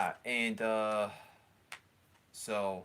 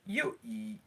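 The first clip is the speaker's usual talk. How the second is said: voice crack; 11 semitones higher than usual. Real, not acted. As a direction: voice cracks up high. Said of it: "well, that was a voice crack".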